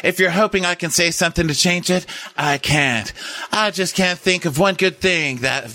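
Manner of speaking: high put-on speechmaking voice; hammed-up funeral speech; Gail's eulogy, acted. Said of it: "a dead Harvey speech".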